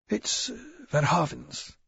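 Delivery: hoarse, hesitant and high; a hoarse, nervous shopkeeper. A narrator's reading. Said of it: "His voice is hoarse".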